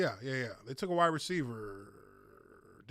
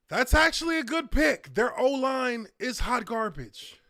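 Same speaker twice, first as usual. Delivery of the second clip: loud, high burst of excitement; raised voice, emphatic hot take; excited approval; 7 semitones higher, 7 dB louder than usual. Real, not acted. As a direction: react loudly to a welcome surprise. Reacting live to draft pick.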